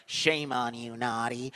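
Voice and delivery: soft, put-on scolding voice; lightly mock a prim telling-off; mock preachy scolding. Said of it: "I ain't gonna preach to them".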